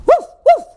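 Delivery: two very high little woofs; dog woof. A narrator's reading. Hungry dog chasing a cat, high woofs. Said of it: "howled Hungry Dog".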